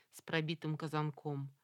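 ordinary delivery, nothing marked

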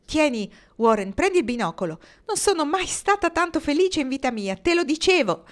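loud, high happy rush; overjoyed. A narrator's reading. Marnie handing Archwood the binoculars, overjoyed. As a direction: gush with happiness.

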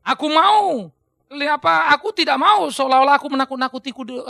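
loud, emphatic protest; Paulus. Acted. Preacher as Paul answering his critics, loud protest. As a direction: declare it forcefully in self-defence. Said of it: "oleh karena itu dia mengatakan".

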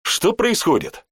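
high bewildered question; confused. A narrator's reading. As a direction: ask in confusion. Confused after falling.

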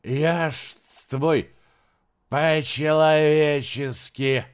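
put-on very slow, pleading drunk slur; blackout drunk. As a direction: drag out every word drunkenly. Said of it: "еле ворочая языком".

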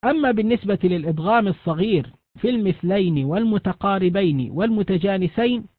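ordinary talk, nothing marked

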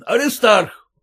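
loud call, falling. A narrator's reading. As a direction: summon the son. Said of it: "стал он звать сына". (отец зовёт сына)

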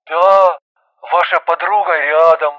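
mildly flustered male caller; a touch of bewilderment; flustered babble. A narrator's reading. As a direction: answer in hesitant confusion. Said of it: "растерянно пролепетал он".

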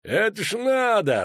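high, slow outraged cry; indignant dwarf commander exclaims. A narrator's reading. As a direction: burst out in angry disbelief. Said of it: "рванул бороду Барклай Эльс".

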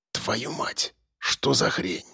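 hushed shocked whisper. Acted. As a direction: breathe it out in a whisper. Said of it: "прошептал Сергей".

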